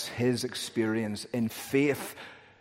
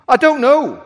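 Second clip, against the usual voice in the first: "I don't know" yelled much higher and louder; shouted reply; 14 semitones higher, 14 dB louder than usual. Acted. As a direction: throw "I don't know" back at full volume. Thief repeats answer to angel.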